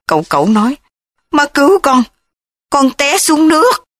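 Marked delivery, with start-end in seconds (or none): 1.3-3.8 high, panicked plea; terrified. A narrator's reading. Frightened son begging his mother to save him. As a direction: beg mother for help in terror. Terrified child under the bed.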